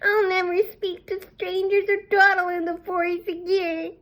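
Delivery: put-on high little girl's voice; a chastened child's promise; promising girl. A narrator's reading. The girl promises her grandmother to be careful in a high child's voice.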